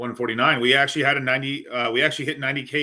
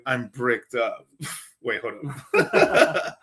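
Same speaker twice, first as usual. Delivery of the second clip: laughing joke; much slower than usual. Real, not acted. Streamer joking with his guest.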